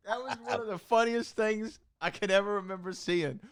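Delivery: high laughing recollection. Real, not acted. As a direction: laugh through the words. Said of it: "laughing at memory".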